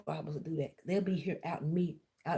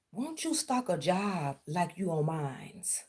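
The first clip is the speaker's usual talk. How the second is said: ordinary delivery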